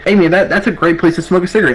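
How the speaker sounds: high, fast, overly breezy pitch; mock casual suggestion. To the drunk private, steering him off the street, fake-casual. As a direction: sell the alley like it's no big deal.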